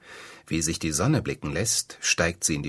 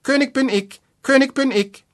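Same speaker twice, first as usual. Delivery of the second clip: much higher, louder piercing bird voice; fine piercing voice; 8 dB louder than usual. A narrator's reading. The wren calls down to the birds, high and piercing. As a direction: crow it out thin and shrill, like a tiny wren. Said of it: "mit feiner, durchdringender Stimme".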